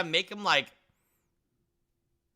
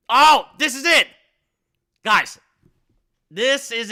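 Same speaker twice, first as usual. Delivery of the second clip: loud, high burst of delight; excited; 6 semitones higher, 9 dB louder than usual. Real, not acted. Streamer seeing the perfect image appear, loud and thrilled. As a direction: cry out like you've struck gold.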